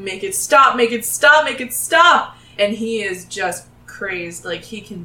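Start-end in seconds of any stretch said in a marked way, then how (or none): 0.0-2.4 loud, rising plea in pain; half-screamed begging, louder and higher each time; mock screaming. Gnome clutching his head begs for relief, screaming. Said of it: "he's kind of screaming".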